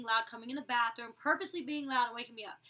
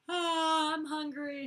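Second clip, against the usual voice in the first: hungry whine; 4 semitones higher, 4 dB louder, much slower than usual. Retold or imitated. Retelling own whine on phone.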